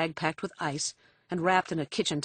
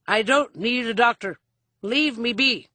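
put-on loud, higher-pitched drunken protest; irritable refusal; 5 semitones higher, 6 dB louder than usual. A narrator's reading. Father refusing his daughter's help, irritable. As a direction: snap back and wave the help away.

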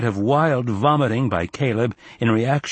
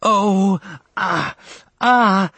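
high, loud, drawn-out moans; voluptuous cry; 11 semitones higher, 5 dB louder, much slower than usual. A narrator's reading. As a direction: cry out with relish. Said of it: "Caroline cried out voluptuously".

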